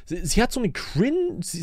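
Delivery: ordinary talk, nothing marked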